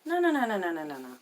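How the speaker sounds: rapid string of noes tumbling down from high; high, loud burst of refusal; emphatic refusal. Retold or imitated. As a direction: cut them off loudly, starting high and falling. Retold refusal to visitors.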